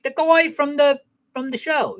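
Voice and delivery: very high imitation of a wife; vague wife. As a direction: play the wife vaguely explaining, pitched right up. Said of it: "she was very vague".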